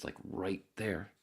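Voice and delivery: ordinary talk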